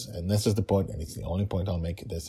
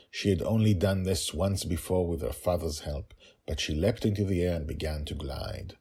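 ordinary delivery

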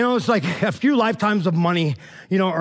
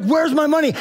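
higher, sharp mock demand; 7 semitones higher than usual. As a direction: bark it at the debtor, pitched up. Servant demands payment from debtor.

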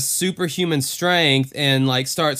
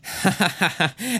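high, manic cackle; mock-evil cackle, high and quick; evil laugh; 6 semitones higher than usual. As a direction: imitate a really evil laugh. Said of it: "laughing this really evil laugh".

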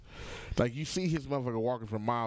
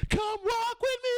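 high mock voice; 3 dB louder than usual. Quoting a movie scene.

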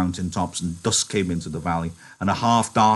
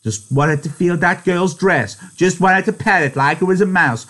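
mocking little girl; 11 semitones higher, 7 dB louder than usual. George mimicking Lenny like a little girl, mocking. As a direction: mimic him like a taunting girl. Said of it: "manner of a little girl's when they are mimicking".

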